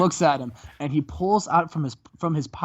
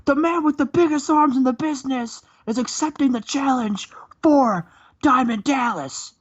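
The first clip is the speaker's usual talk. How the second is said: high, loud falsetto wrestler promo, partly whispered; Scott Steiner promo; 10 semitones higher, 3 dB louder than usual. Retold or imitated. Host imitates Scott Steiner cutting a promo on the mic, high. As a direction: ham up a strained, high wrestling boast. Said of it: "He looks and says".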